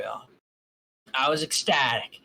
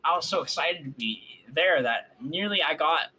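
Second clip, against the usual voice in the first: ordinary delivery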